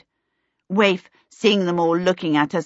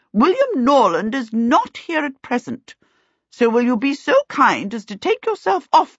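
higher, firm aunt voice; imperious aunt; 7 semitones higher, 4 dB louder than usual. A narrator's reading. Aunt Sempronia ordering the kobold out, imperious. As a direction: dismiss an intruder imperiously.

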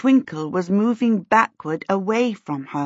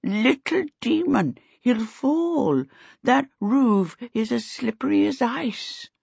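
put-on slow, high, doddering old man voice; old servant Sim; 6 semitones higher, slower than usual. A narrator's reading. Sim answering Charmaine, slow and quavering. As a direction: creak it out like a frail old servant. Sim's voice in the story.

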